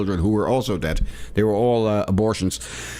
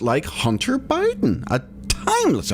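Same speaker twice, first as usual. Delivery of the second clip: mock squeak; 12 semitones higher than usual. Sarcastic aside.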